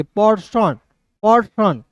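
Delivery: "पर्सन" with a short er sound; right pronunciation. Shown as a demonstration. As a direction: say "पर्सन", not "पेड़सन". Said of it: "इस तरीके से". ("पर्सन" का सही उच्चारण)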